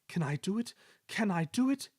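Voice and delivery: rising, worried puffing; anxious. Acted. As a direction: doubt the climb ahead. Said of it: "he puffed anxiously".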